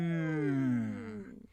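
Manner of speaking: a held hum in the middle range. Shown as a demonstration. Streamer humming mid-range for the exercise. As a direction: hum steadily at mid pitch. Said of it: "One, two, three".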